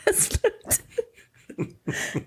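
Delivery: high, breathy laugh on "last night"; laughing through it. Real, not acted. Wife laugh-crying telling about last night, laughing. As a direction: giggle helplessly through the words.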